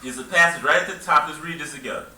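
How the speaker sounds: ordinary delivery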